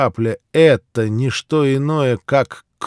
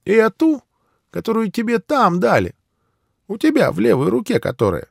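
put-on old man voice; 11 semitones higher than usual. Old man demanding the figure.

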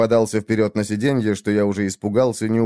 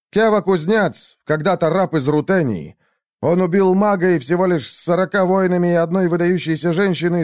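commanding king; 8 semitones higher than usual. A narrator's reading. Shah praises before the court.

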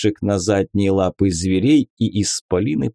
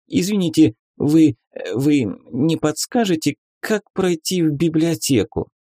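put-on timid, higher stammering girl; frightened stammer; 6 semitones higher than usual. A narrator's reading. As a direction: stammer it out, scared and innocent. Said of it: "Испуганно хлопая ресницами, заикаясь на каждом слове".

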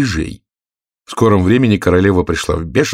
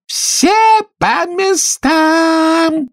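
very high, long-drawn commanding yell; thunderous shout; much slower than usual. A narrator's reading. Queen orders players to their places, thunderous drawn-out shout. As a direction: stretch each word into a slow holler. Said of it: "закричала королева громовым голосом".

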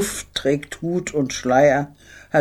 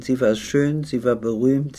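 usual speaking voice

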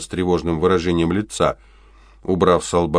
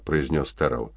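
plain reading voice

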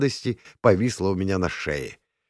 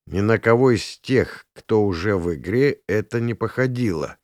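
plain reading voice

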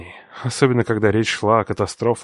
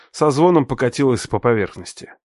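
plain reading voice

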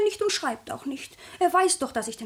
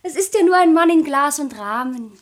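much louder, defensive outburst; loud protest; 12 dB louder than usual. Acted. Sabine protesting to her grandmother, loud and defensive. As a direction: blurt it out, defending yourself.

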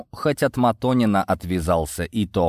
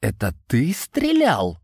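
slow, higher, accusing question; snappish; 4 semitones higher, slower than usual. A narrator's reading. Борис turning on Йован over the shooting, snappish. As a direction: lean hard on the accusation. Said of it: "окрысился Борис".

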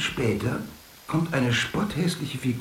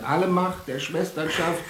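mock shout; 10 semitones higher than usual. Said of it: "[name] schreit".

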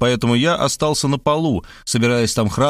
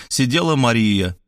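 usual speaking voice

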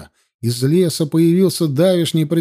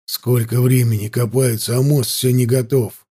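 lightly voiced, low grumbling soldier; grumbling guard; 5 semitones lower than usual. A narrator's reading.

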